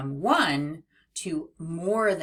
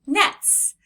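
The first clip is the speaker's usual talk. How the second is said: mats jumped high and loud; cheerful read-along model; bright excited reading; 11 semitones higher, 7 dB louder than usual. Shown as a demonstration. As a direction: pop the word up high. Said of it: "N-et-s".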